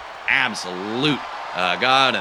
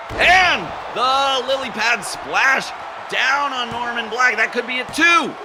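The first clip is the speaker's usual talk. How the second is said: excited commentary; 10 semitones higher, slower than usual. Acted. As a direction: build to the pinfall climax. Play-by-play finish, count.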